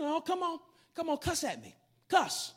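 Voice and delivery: insistent urging. Retold or imitated. Pastor goads the kid to cuss at him, insistent urging. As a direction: egg him on, pushing harder each time.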